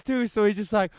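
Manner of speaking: ordinary delivery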